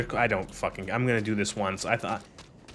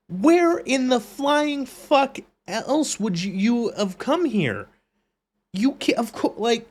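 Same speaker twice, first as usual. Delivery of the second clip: high, loud, outraged ranting; exasperated yelling; 12 semitones higher, 3 dB louder than usual. Real, not acted. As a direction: scream it out in disbelief. Ranting at game dialogue.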